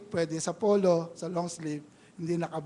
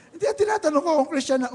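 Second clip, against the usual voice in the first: exasperated retort; 7 semitones higher, 6 dB louder, faster than usual. Retold or imitated. Pastor retorts to the evasive answer.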